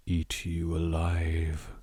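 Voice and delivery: whispered low and slow, menacing; eerie whisper. Acted. Narrating the haunted mansion to viewers, in a slow eerie whisper. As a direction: breathe it out like a threat.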